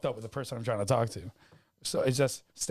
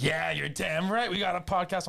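cocky brag; 4 semitones higher than usual. Retold or imitated. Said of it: "And he's like".

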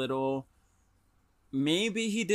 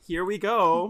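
Mario catchphrase; 4 semitones higher, 7 dB louder than usual. Retold or imitated. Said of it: "one of Mario's taglines is".

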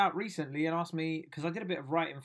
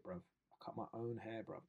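usual speaking voice

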